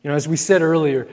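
ordinary talk

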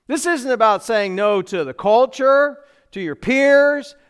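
high, loud, driving sermon voice; preaching at full force. Acted. Pastor driving the point home to the congregation, loud fervent preaching. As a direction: build to a rising preacher's climax.

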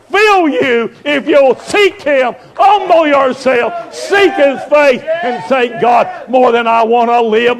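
shouted high revival preaching; fiery revival preaching, almost shouting; preaching at full force. Acted. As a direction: cry it out to the congregation. Preaching climax.

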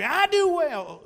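high, loud, slow, defiant reply; defiant retort. Acted. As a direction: throw the answer back at God with stubborn pride. Jonah defiantly answers God.

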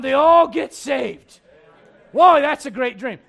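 loud, slow, joyful shout; laughing shout of triumph; excited shout. Acted. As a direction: cheer as the crowd gets saved. Crowd saved, preacher exults.

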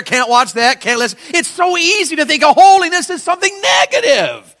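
high, loud pulpit preaching; fiery preaching. Acted. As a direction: preach it out with full fire. Preacher at full force.

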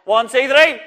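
shouted count. Shown as a demonstration. Teacher cueing the children, loud count. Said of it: "I am gonna shout out and say".

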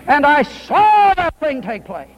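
preaching climax. Acted. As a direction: stretch each word out to the back pews. Sermon climax.

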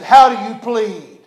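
loud, slow judge's demand; stern magistrate question. Retold or imitated. Magistrate asks for plea.

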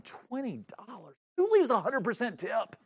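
incredulous. Retold or imitated. Preacher replays his grudging thought over the tip, incredulous. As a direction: balk in disbelief at the cost. Begrudging inner reaction to tip.

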